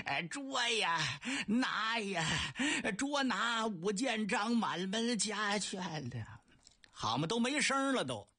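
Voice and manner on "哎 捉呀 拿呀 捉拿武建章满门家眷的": slow, strained cry with the voice giving out; a croaking call with nothing left in the throat; hoarse worn-out shout. Acted. Soldiers whose voices are gone after dozens of shouts, slow strained croak. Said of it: "这几位嗓子都哑了".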